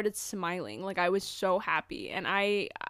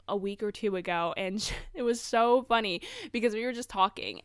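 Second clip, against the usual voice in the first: ordinary talk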